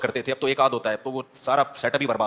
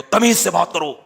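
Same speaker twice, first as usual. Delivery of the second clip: husband scolding wife; 2 semitones higher, 4 dB louder than usual. Retold or imitated. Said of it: "آپ بیگم سے کہہ رہے ہو".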